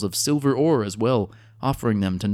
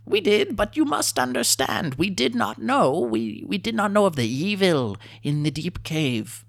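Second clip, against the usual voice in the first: much higher squeaky plea; pleading kobold; 10 semitones higher than usual. A narrator's reading. Kobold chief pleading innocence with the adventurers, high and anxious. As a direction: beg to be understood, little lizard.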